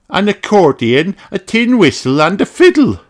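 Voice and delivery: high, listing rabbit lady; Miss Rabbit voice. Acted. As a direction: rattle off the list as Miss Rabbit.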